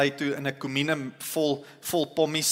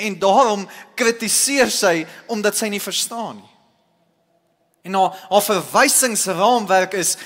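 raised, loud pulpit delivery, driving each point home; full-force preaching; 5 semitones higher, 6 dB louder than usual. Acted. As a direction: preach it out to the congregation with rising fervour.

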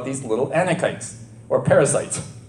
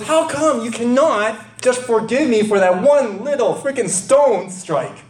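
high, aggrieved pleading complaint; hammed-up indignant protest; mock whining Moses; 4 semitones higher than usual. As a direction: whine at God like a passed-over employee. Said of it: "Moses goes".

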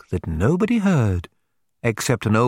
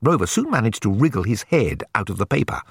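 plain reading voice

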